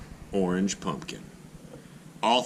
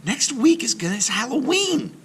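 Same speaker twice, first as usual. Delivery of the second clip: put-on very high, louder girl voice; girl's voice; 4 dB louder than usual. Joan opens the club meeting, high and urgent. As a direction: speak up like a worried child.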